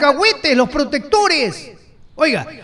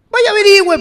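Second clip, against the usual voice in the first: "Vaya, averigüe" louder and higher; a raised, challenging repeat of "Vaya, averigüe"; loud insistence; 8 semitones higher, 6 dB louder than usual. Real, not acted. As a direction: push the listener to go check, forcefully. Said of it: "Vaya, averigüe en qué estado".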